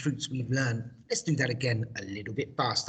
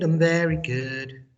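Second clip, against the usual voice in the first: "very" punched, louder; stress on very; 4 semitones higher, 6 dB louder than usual. Shown as a demonstration. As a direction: lean hard on "very". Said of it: "I do the English".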